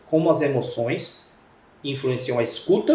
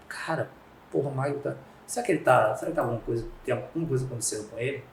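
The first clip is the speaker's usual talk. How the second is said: much softer, worried wondering aloud; concerned and soft; 7 dB quieter, faster than usual. Retold or imitated. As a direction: voice quiet concern for a colleague. Empathetic concern for colleague.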